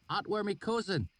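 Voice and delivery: high rustic voice; put-on valley dialect. A narrator's reading. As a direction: fake the local dialect. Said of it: "my sudden change in dialect".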